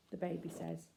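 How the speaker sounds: ordinary delivery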